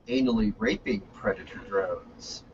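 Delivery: पूरी लाइन बहुत नीची, दबी आवाज़ में. Pretend. Mock Obama threat, exaggerated.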